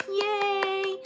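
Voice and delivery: high, loud squeal of delight; excited greeting. Real, not acted. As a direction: greet a friend with loud, laughing joy. Delighted greeting as friend joins.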